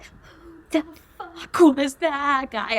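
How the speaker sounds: high, slow yelled line; mock singing scream. Shown as a demonstration. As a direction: belt it like screaming along at a concert. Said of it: "I always scream that one particular line".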